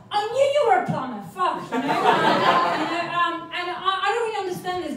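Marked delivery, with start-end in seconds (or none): 0.1-1.6 mum's retort. Retold or imitated. Mum's voice retold in a story.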